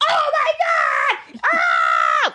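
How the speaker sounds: high shrieking crowd cheer, stretched out; mock crowd scream. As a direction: scream like the whole audience erupting. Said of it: "everybody's like".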